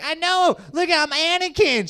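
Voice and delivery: high, eager playing along; mock excitement. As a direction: exclaim it in a giddy high pitch. Comic playing along with joke.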